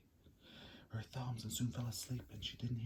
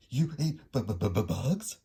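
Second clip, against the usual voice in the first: louder, shocked stutter on bugs; stammering shocked bat; 8 dB louder than usual. A narrator's reading. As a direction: trip over bugs in disbelief. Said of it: "stuttered one".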